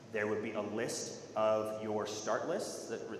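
normal talking voice

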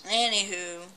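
ordinary talk, nothing marked

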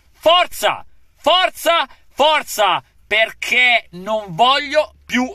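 high, loud chant of forza; rally the team, shouting; fired-up shout. Real, not acted. Cheering the team on.

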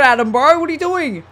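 ordinary delivery

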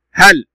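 هل short and loud, stopped on the l; sukun stop. Shown as a demonstration. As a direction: cut هل off at the l. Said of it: "نتوقف".

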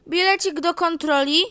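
loud, high ticket check; mock train conductor calling out. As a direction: demand the ticket officiously.

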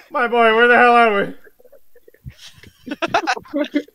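high, very loud bewilderment; panicked. Acted. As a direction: cry out, disoriented and alarmed. Panicked doctor woken by song.

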